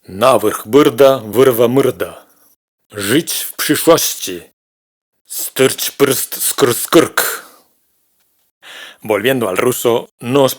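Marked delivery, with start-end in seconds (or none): 0.0-7.5 slow, loud, over-articulated tongue-twister of consonants; impossible consonant clusters. Shown as a demonstration. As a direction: grind out each cluster slowly and deliberately. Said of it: "Hay cosas que no se pueden". (amontonando consonantes imposibles, despacio y con fuerza)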